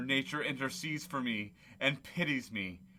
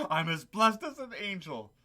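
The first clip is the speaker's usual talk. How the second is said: high and laughing; laughing through words; 7 semitones higher, 5 dB louder than usual. Acted. Scrooge giddy with joy, speaking through laughter.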